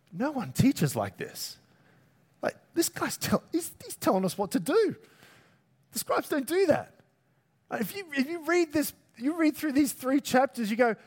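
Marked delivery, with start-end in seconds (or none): 0.1-6.9 high-pitched, incredulous onlookers; amazed, disbelieving protest, pitched up; astonished crowd. Acted. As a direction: act out a crowd that can't believe its ears. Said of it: "the crowds were astonished at his teaching".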